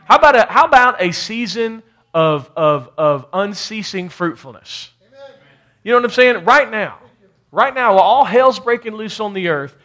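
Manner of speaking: loud, high preaching voice; preaching at full force. Real, not acted. Preacher rallying the church to fruitfulness, full force.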